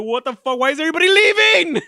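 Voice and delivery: high, loud mock-panicked yell; hammed-up squeal of abandonment; imitated panic. Daughter watching everyone leave the room, hammed-up panic. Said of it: "went from cool to fucking panicking".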